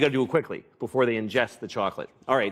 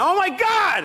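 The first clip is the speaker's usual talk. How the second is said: shrill panicked oh my God, much higher; alarmed cry; slower than usual. Retold or imitated. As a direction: yelp it in sudden alarm. Shouting at dog who ate chocolate.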